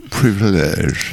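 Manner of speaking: privilège said slowly with an English accent, stress on the last syllable; English accent French. Shown as a demonstration. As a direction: draw out privilège the way an English speaker says it. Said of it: "j'adore entendre le mot, euh, privilège avec un accent, euh, anglais".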